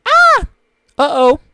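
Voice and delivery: sudden high loud yelp, then an uh-oh; loud squeaky cry then uh-oh; imitated app yelp. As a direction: mimic a cartoon sound effect. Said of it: "translated to being like".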